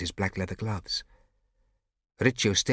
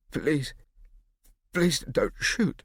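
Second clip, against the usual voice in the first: slow, high pleading; frightened stutter; 7 semitones higher, 3 dB louder, much slower than usual. Acted. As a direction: plead shakily, drawn out. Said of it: "Riccio stuttered".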